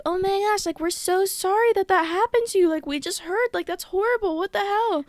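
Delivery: a light imitation of fake concern; sympathetic classmates. Classmates telling her they're sorry it happened, lightly mimicked sympathy.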